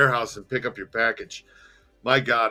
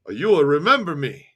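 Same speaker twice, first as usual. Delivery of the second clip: put-on louder, lilting Italian accent; thick Italian accent; 5 semitones higher, 6 dB louder than usual. Smiling Jimmy greets the narrator, thick Italian accent. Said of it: "in his thick Italian accent".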